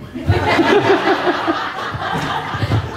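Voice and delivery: sudden high, airy laugh at his own joke; a real, breathy burst of laughter. Real, not acted. Jokes his family is unhappy.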